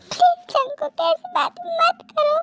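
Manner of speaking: distressed woman's voice; pleading woman. Acted. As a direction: plead with him in a high, upset voice. She begs him to stop.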